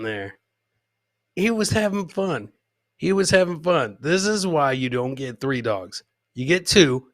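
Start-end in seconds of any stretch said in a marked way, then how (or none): none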